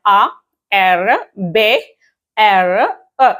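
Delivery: slow French letter names for ആബ്ര; spelling in French. Shown as a demonstration. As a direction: spell each letter out slowly, French style. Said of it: "എങ്ങനെ pronounce ചെയ്യുക". (French അക്ഷരങ്ങൾ പതുക്കെ)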